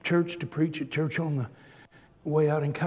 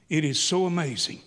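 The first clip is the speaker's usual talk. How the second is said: ordinary delivery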